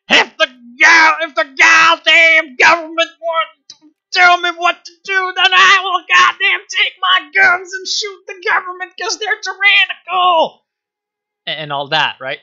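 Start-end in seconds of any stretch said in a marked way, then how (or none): 0.1-10.6 high, loud shouting caricature of a frontier capitalist; ranting gun-nut merchant. Retold or imitated. Merchant mocked as screaming at the tyrant government. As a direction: scream it like a cartoon anti-government trader. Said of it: "pretty much like".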